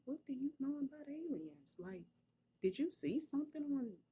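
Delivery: high-pitched, startled questioning; retold incredulity. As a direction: act out a mother taken aback by her child. Mother retells startled questioning of child.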